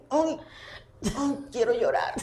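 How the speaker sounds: a hint of a plainsman whimpering, playful; mock whiny plainsman. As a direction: lightly mimic a tough man begging to cry. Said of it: "ese llanero hacía así".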